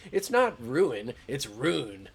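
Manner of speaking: slow, loud contrast of "ruin" and "rune"; mock correcting pronunciation. Streamer mimics chat's correctors, "ruin" ROO-in then "rune" as one syllable. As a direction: pit two-syllable "ruin" against one-syllable "rune", mockingly. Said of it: "people coming into my shit with ruin".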